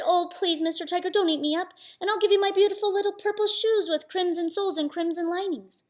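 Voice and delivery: put-on high-pitched pleading child figure; pleading Samba. Little Samba bargaining with a tiger for his life, high pleading.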